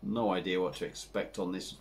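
usual speaking voice

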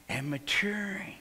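whispered. Real, not acted. Whispered end of list.

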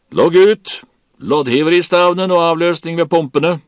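very high, very loud commands; Kaptein Wilkinson ordering. A narrator's reading. Captain to crew from the afterdeck, shouted orders. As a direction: holler orders across the deck. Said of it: "beordret kaptein Wilkinson".

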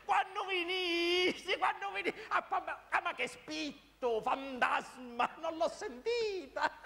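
high, slow drawn-out call; high-pitched holler. Acted. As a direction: keep calling in a high, stretched voice. Calling to Maruzza, no answer.

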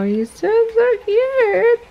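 high, loud, slow cooing. Real, not acted. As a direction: squeal slowly over an adorable puppy. Gushing over the puppy.

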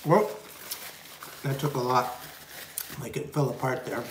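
talking with food in mouth; pausing to chew between words. Real, not acted. Seen 3: pizza gone from hands; lips closed, chewing motion between phrases.

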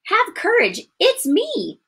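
high, emphatic calling voice; Jesus/reassuring call. Acted. Jesus to his scared disciples on the boat, calling reassurance. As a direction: call out to frightened followers.

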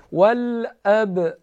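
والاب with the ب bouncing, clearly released; qalqala on b. Shown as a demonstration. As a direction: make the ب in والاب echo. (قلقلهٔ با در والاب)

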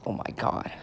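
breathless whispered disbelief; under your breath, aghast; a stunned whisper to himself; whispered oh my god. Real, not acted. Reacts to someone waving at him.

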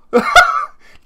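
very high breathless laugh after a fright; real shocked laughter; shrieking laugh. Real, not acted. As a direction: laugh off the scare.

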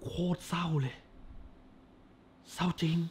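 barely voiced, real sorrow at the ending; a reviewer overcome by the film's sad end; hushed sadness. Real, not acted.

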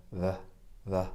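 short grunted uh sounds, schwa; schwa practice. Shown as a demonstration. Practising the schwa sound on camera. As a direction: warm up saying the schwa. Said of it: "po prostu ćwiczę szła".

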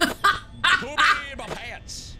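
laughing. Real, not acted. Laughing in panic.

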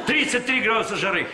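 usual speaking voice

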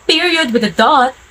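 normal talking voice

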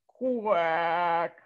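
a quack that breaks into a sob; crying quack. Acted. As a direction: quack as if close to tears. Duck fed up, near tears.

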